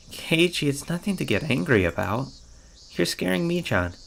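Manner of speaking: put-on high girlish voice, gently chiding; calm him down in a high voice; pleading Rena. Rena calms the angry hero.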